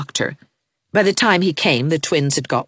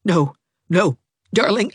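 chattering teeth; 5 semitones higher than usual. A narrator's reading. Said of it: "assured her through his chattering teeth".

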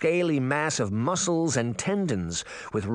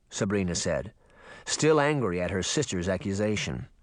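ordinary delivery

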